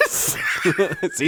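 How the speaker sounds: ordinary talk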